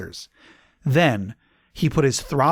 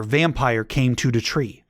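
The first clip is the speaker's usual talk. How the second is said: usual speaking voice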